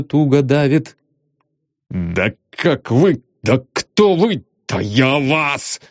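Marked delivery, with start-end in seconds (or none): 1.9-5.8 slow choking fury; splutter, lost for words; sputtering rage. A narrator's reading. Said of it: "не мог подобрать подходящего начала".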